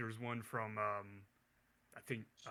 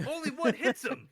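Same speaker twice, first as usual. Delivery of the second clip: laughing through the words, high and loud; 13 semitones higher, 9 dB louder than usual. Real, not acted. Podcaster mocking a movie scene, laughing high. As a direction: crack up while telling it.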